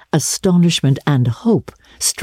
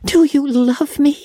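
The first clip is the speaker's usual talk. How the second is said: put-on high, earnest girlish question; emotional cry; 12 semitones higher than usual. A narrator's reading. Anne asks whether her friend loves her, emotional cry. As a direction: ask it like an overwhelmed girl. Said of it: "cried Anne".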